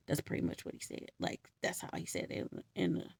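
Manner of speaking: soft, whispered side comment; whispered aside. Real, not acted. Aside after quoting him.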